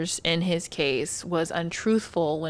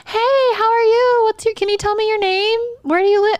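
chipper nurse voice; 7 dB louder than usual. Retold or imitated. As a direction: greet the patient super bright. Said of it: "High-pitched therapist voice".

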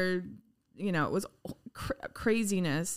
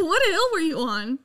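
angry demand; 5 semitones higher, 6 dB louder than usual. Retold or imitated. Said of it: "I was like".

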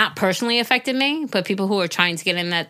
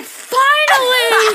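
high, laughing, overjoyed shout; excited shout; 6 dB louder, much slower than usual. Retold or imitated. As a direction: yell it out with glee. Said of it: "I'm like".

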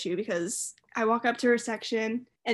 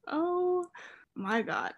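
retold stunned reaction; 6 semitones higher, 4 dB quieter, much slower than usual. Froze on seeing the tweet.

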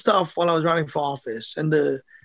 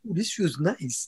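softer, savouring sigh of comfort; soft contented ooh; 7 dB quieter than usual. Pretend. Boxer miming putting on new slippers, soft contentment. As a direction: purr it like slipping into comfy shoes.

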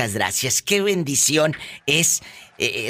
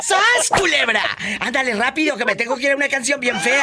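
excited shout; 10 semitones higher than usual. Acted. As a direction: burst out with the radio host's hype. Host's catchphrase outburst.

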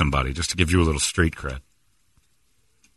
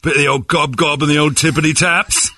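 high, loud cartoon English accent; hammed-up British gibberish; mock British accent; 8 semitones higher, 6 dB louder, slower than usual. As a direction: needle him in a silly English accent. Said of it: "You're doing the accent again".

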